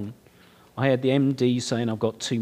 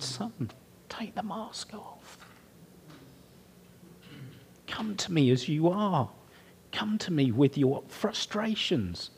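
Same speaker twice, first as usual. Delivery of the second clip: tender whisper of God; 5 semitones higher than usual. Acted. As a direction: coax gently, almost whispering. God voiced as tender father.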